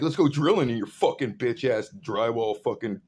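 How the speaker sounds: loud, heated mockery; retold angry taunt. Speaker mocking the tradesman he confronts, loud angry taunt. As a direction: jeer at him at full volume. Retells chewing out the big dude.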